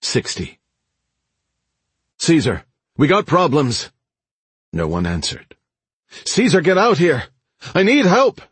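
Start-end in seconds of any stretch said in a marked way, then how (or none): 2.2-3.9 higher, urgent call. A narrator's reading. Man calling shop owner about trouble, urgent call. 6.2-8.4 high, loud cry for help; urgent shout. A narrator's reading. Man bursting into shop for help, urgent shout. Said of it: "Zig shouted".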